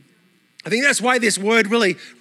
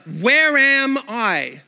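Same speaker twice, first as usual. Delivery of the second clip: "Where am I?" stretched very slow; slow pondering; much slower than usual. Acted. Pastor voicing a listener's question, slow and pondering. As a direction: ask it slowly, as if wondering. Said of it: "to help you ponder".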